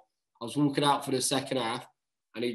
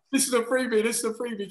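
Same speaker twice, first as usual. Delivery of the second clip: gleeful mock call; 8 semitones higher than usual.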